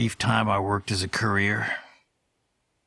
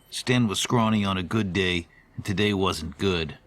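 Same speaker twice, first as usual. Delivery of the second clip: usual speaking voice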